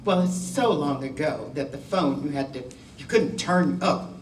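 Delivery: ordinary talk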